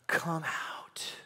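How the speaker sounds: gentle whispered summons; whispered call. Acted. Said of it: "Jesus is saying".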